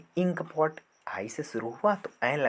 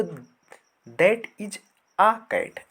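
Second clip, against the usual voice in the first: slow model sentence; 6 semitones higher, 5 dB louder, much slower than usual. Shown as a demonstration. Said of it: "teacher models the example slowly".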